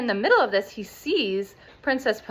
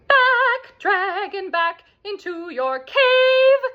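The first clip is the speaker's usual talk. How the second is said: very high, loud, slow shouted orders; commanding shout; 12 semitones higher, 6 dB louder, much slower than usual. Retold or imitated. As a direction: drive the dragon off with a shout. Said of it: "And they say".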